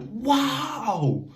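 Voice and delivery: high, loud astonished wow; stunned wow. Retold or imitated. Kid watching the getaway car drive off, high astonished wow. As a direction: gasp it out in disbelief. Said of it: "I'm like".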